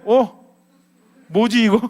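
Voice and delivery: loud, high-pitched mock bafflement; taken aback. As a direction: react thrown off by an unexpected agreement. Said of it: "상대방이 수긍을 해버리면 어때?". (당황한 듯 높고 큰 소리로 어리둥절하게)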